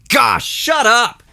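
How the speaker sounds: slow, high, drawn-out scorn; exasperated. Real, not acted. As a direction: tell him to shut up, fed up. Exasperated at the fake host.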